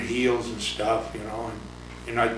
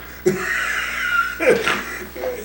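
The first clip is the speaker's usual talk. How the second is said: breathy laugh; 12 semitones higher than usual. Real, not acted. Laughing at his hitting.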